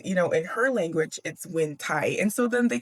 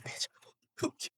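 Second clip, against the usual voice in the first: imitated whispered curse, much softer; mock menacing whisper; 12 dB quieter than usual. Girl confronting someone near her dog, mock whisper. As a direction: whisper it as the character's retort. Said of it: "that's when Karana is like".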